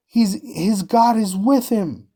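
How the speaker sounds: high, slow voice of the amazed crowd; awed onlookers. Retold or imitated. Preacher voicing people awed by God's power, high and slow. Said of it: "the people are gonna say".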